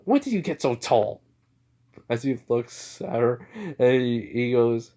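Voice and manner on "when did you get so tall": higher-pitched, mock-amazed exclamation; surprised mom. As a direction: exclaim it like an astonished mother. Mom exclaims at son's height.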